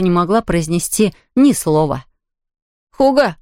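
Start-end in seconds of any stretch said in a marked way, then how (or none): 2.9-3.4 calling out. A narrator's reading. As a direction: call out to find him. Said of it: "позвал Том".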